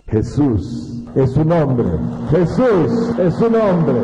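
slow, loud, proclaiming the name. Acted. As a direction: lean into it with reverence.

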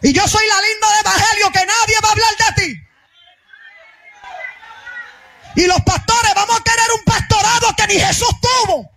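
high-pitched, forceful pulpit delivery; fiery preaching. Real, not acted. Pastor mocking thin-skinned believers and pastors, fiery preaching. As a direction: ring it out to the whole church.

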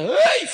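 chillido agudo y fuerte de susto; grito de susto. Retold or imitated. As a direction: chilla como muchacha asustada. La hermana se asusta y huye. (la hermana oye el piropo y grita asustada)

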